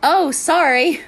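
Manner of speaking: high, loud startled "sorry"; retold loud apology. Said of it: "my peer looks at me and says".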